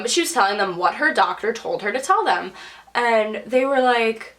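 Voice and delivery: ordinary delivery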